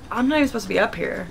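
ordinary delivery

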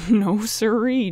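slow, loud and firm refusal; insisting. A narrator's reading. Joe refuses to show Chet the fireworks, firmly. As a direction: draw out a teasing no. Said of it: "Joe insisted".

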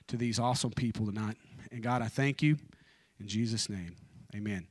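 soft, sincere prayer voice; earnest prayer. Real, not acted. Preacher opening his sermon with prayer to God, soft and sincere. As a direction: pray quietly before the talk.

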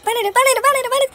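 alarmed loud panic. Real, not acted. Hiding, about to be found.

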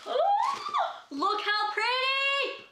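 high squeal, then slow, drawn-out delight; excited squeal. Real, not acted. Opening a friend's gift box, high excited squeal. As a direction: squeal at the pretty purse.

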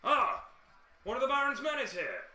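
high, loud farmer announcing to the room; delighted farmer shouts the news; excited farmer. Acted. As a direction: call it out so the whole tavern hears. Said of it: "see a farmer stand up and go".

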